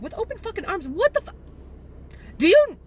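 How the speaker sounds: sputtering rage at the client; incredulous outburst. Retold or imitated. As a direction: spit it back at her in disbelief. Furious retort, quoted outburst.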